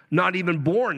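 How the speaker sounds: ordinary delivery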